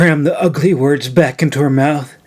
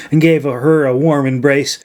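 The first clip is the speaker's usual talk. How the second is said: usual speaking voice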